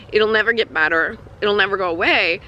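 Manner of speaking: normal talking voice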